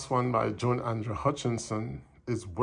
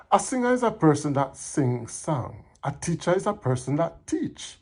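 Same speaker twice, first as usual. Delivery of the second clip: higher, livelier comic storytelling in Patois; poem recital; 5 semitones higher, 4 dB louder than usual. Acted. Employer telling of a bleach-happy helper, animated recital. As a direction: perform it as animated Jamaican verse.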